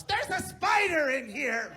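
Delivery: high, drawn-out call of alarm; mock distant scream. Wife calls husband from far room about spider, mock scream. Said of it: "my wife will scream from the other side of the house".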